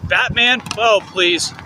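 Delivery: normal talking voice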